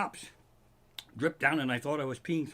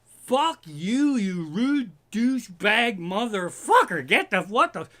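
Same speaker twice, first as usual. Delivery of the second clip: shouted insult; 6 semitones higher, 7 dB louder, slower than usual. Retold or imitated. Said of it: "the people that work there would go".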